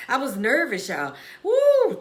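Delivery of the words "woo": excited woo. Real, not acted. Admits being nervous, exclaims.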